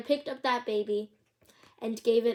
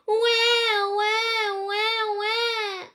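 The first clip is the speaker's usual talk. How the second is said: put-on high sung baby wail; baby crying from far away; 10 semitones higher, 3 dB louder, much slower than usual.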